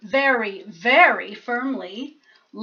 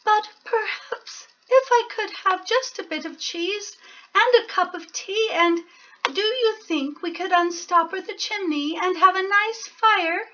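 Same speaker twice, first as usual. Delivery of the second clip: a tiny mouse politely angling for tea; polite little mouse; 8 semitones higher than usual. Acted. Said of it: "said the mouse".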